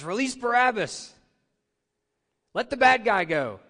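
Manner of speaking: loud, high crowd cry for Barabbas; crowd shout. Retold or imitated. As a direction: shout it like a mob demanding release. Crowd demanding Barabbas released.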